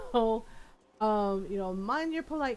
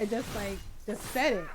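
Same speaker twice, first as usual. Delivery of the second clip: usual speaking voice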